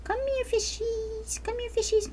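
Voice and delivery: high coaxing sing-song; talking to fish. Pretend. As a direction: coax the fish sweetly.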